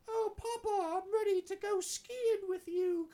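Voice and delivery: put-on very high, slow posh English boy voice; posh British kid. Son telling his mercenary dad he's ready to ski, in a high posh British voice. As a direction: play the eager little English son. Very good accent.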